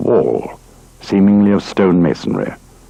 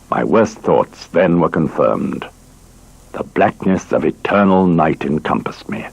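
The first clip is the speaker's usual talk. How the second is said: usual speaking voice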